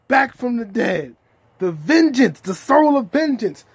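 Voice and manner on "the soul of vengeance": normal talking voice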